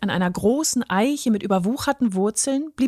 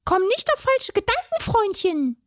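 very high, cross fairy voice; protesting fairy; 12 semitones higher than usual. A narrator's reading. Fairy protests at the troll, very high and cross. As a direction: wag a finger at the big oaf. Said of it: "protestierte sie".

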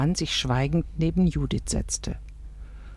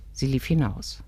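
ordinary delivery, nothing marked

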